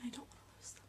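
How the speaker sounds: barely breathes the words, fighting tears; choked whisper. Real, not acted. Telling viewers about her best friends, choking into a whisper. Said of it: "Oh, I'm getting emotional".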